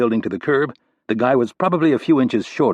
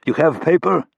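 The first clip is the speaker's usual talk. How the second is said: put-on Russian accent, pitched up and pushed; a heavy Russian accent, gruff and demanding; thick Russian accent; 7 semitones higher than usual. A narrator's reading. Russian bouncer stopping Rapp at the door, thick Russian accent. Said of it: "he asked with a thick Russian accent".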